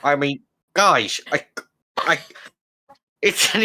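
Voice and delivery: posh voice with a lisp; bewildered gentleman with a lisp; fancy lisping voice. Acted. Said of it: "No, but you do sound fancier".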